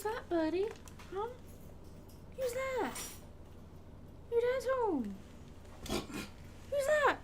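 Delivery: high sing-song pet talk; welcoming someone home. Real, not acted. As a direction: coo up high like greeting a dog. Greeting pet as dad comes home.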